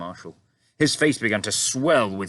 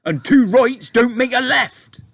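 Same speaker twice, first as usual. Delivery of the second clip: high, loud giant's cry; cried out; 11 dB louder, slower than usual. A narrator's reading. BFG retorting to the Queen, loud high cry. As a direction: call it out boldly. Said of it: "cried the B F G".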